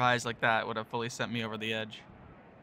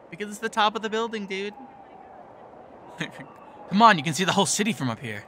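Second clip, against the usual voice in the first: eager Mark; 8 semitones higher, 5 dB louder than usual. Acted. Mark cheering on the rooftop.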